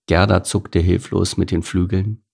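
ordinary delivery, nothing marked

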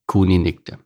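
usual speaking voice

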